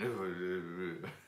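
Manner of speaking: wordless mock muttering under the breath; imitated muttering. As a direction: mumble nonsense like someone busy rummaging. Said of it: "and he's muttering".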